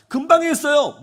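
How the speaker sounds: put-on high whiny child protest. Child protests to his mother, high whiny voice. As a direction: insist like a sulky kid.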